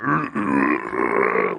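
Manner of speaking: low, slowed-down possession noise; long, deep throat rumble, mimicking a film sound; slowed-down growl. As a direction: imitate an eerie slow-motion sound effect. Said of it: "weird slow down".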